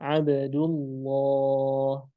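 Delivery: Abdullah with a thick, heavy lam, drawn out slowly; model reading of Abdullah. Shown as a demonstration. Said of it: "Contohnya adalah".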